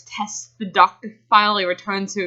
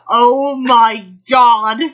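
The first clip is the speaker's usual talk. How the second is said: slow, loud stunned cry; shocked; 3 semitones higher, 7 dB louder, much slower than usual. Real, not acted. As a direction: reel in disbelief. Laughing shock.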